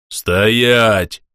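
loud, authoritative halt order; commanding voice. Acted. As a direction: stop them dead with authority. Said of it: "властный голос".

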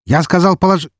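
very high barking command; shouted order. Acted. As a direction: yell the order again. Armed guard repeats order.

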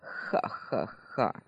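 low, slow breathy villain cackle; evil laugh. Acted. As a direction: laugh darkly, almost voiceless. Villain laughs at terrified people.

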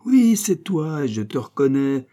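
high, drawn-out cry of accusation; acted prophetic fervour; transported recognition. A narrator's reading. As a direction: denounce him like an inspired prophet. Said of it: "dans un transport de divination".

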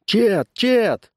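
high urgent call; crying out. A narrator's reading. Someone summoning the constable, crying out. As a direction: call his name from afar. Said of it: "Вдруг кто-то закричал".

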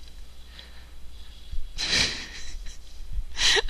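long real fit of amused laughter; helpless, drawn-out laugh. Real, not acted. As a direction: crack up at the story you just told. Laughing at the clerk's swearing.

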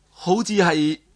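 ordinary delivery, nothing marked

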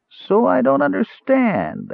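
high, slow sneering echo of his words; mocking snarl. Dwarf mocks Caramon's protest, high slow snarl. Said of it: "Arak snarled".

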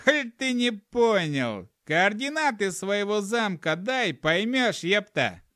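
high-pitched, impatient gnome; high gnome voice, fed up with explaining; exasperated gnome. A narrator's reading. Exasperated gnome explains to slow listener.

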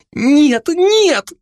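put-on very high, panicked girl's voice; frightened refusal. A narrator's reading. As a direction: cry out in fear, high-pitched. Girl refuses the drunk in fear. (испуганный высокий вскрик)

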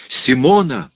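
high, loud, drawn cry; shouting. A narrator's reading. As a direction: shout her name desperately. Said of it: "закричал Рауль". (громкий высокий крик отчаяния)

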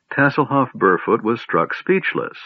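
plain reading voice

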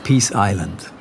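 usual speaking voice

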